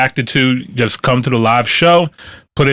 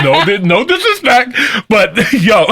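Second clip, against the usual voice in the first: laughing; 12 semitones higher than usual. Real, not acted. Cracking up at colonization joke.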